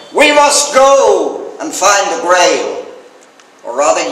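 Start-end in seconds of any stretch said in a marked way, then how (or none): 0.1-2.9 slow, high, loud royal proclamation; kingly cry. Retold or imitated. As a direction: cry out like a king rallying knights. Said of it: "King Arthur cries".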